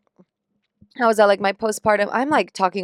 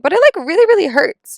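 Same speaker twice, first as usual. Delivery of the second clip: high, louder pained plea; pleading whine; 9 semitones higher, 7 dB louder than usual. Retold or imitated. Patient pleading with her doctor, high whiny plea. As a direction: whine that it really hurts.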